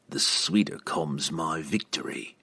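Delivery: soft, slow villain; gloating assassin. Acted. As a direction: savour it slowly and quietly. Assassin answers his rival.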